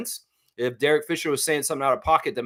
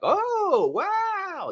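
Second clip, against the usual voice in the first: high, stretched wow; same goofy wide-eyed voice; mock awed kid. Mimicking his own meme reaction, high and drawn out. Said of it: "and then me, like".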